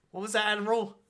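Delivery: acted menacing query, lightly done; Vader confronting. Vader appearing behind the admiral, confronting.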